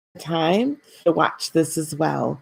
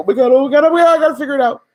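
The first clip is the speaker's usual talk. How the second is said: louder, higher, frantic repeating; anxious mock panic; 6 semitones higher, 5 dB louder, much faster than usual. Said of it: "these will be like".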